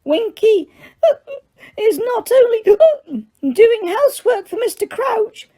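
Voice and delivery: very high tipsy elf squeak, offended; indignant squeak. A narrator's reading. Winky answers Hermione with an offended high squeak. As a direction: squeak it out huffily. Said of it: "Winky squeaked indignantly".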